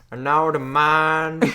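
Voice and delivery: very stretched mock Texas drawl; Southern Texas boy. Echoing the co-host's joke, slow mock Texas drawl. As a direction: drag out the vowels like a Texan. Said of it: "classic Southern Texas boy".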